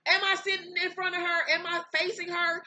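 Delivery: high-pitched indignant questioning; indignant retort. Retold or imitated. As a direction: appeal to onlookers in disbelief. Retold indignant appeal to the crew.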